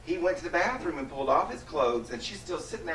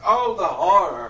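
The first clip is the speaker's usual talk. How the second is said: mock horror; 4 semitones higher, 8 dB louder than usual.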